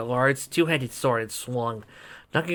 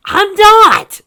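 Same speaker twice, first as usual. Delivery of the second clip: very high, loud outburst; high loud protest; shrill exclaim; 17 dB louder than usual. Acted. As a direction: shriek the denial. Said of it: "Sugarcoat exclaimed".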